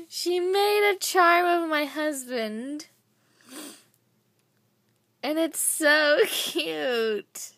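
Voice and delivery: high, slow squealing, laughing at the end; excited squeal. Real, not acted. Showing viewers the charm of her husband, a high delighted squeal.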